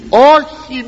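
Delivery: high, sharp retold "no"; mock-shout the denial; Peter's denial shout. Peter denies Jesus, in a high retold cry. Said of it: "να φωνάζει ο Πέτρος".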